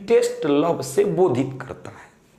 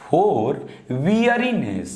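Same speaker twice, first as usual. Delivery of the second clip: "for weariness" read slowly again with weight on "weariness"; slow repeated dictation; 2 semitones lower, much slower than usual. Shown as a demonstration. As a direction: stretch "weariness" for students.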